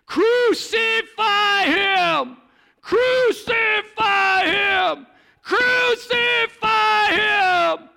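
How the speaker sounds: high, slow, full-throated chant of the mob; crowd shouting. Acted. The crowd shouting at Pilate for Jesus's death, slow loud chant. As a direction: bellow it like an angry crowd demanding death. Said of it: "the people begin to shout".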